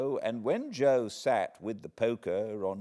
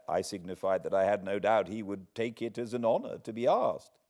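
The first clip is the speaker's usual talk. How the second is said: ordinary delivery, nothing marked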